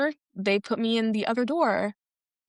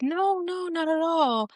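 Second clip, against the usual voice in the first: high, drawn-out retold reassurance; mock placating; 9 semitones higher, slower than usual. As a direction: imitate staff soothing him in a raised pitch. Staff placate man asking if arrested.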